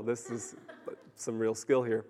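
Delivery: chuckling through the words; laughing. Real, not acted. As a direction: laugh while you say it. Laughing at the thief's throw.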